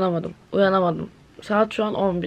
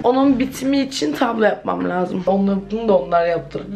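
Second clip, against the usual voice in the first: usual speaking voice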